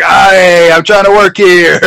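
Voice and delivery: mock exasperated yell; Brett. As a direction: shout it like a fed-up worker, hammed up. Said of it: "And Brett's like".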